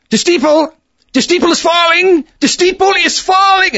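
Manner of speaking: high, loud alarmed cry; panicked report. Retold or imitated. Hank on the phone as the steeple falls, in alarm. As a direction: shout it like the steeple is falling now.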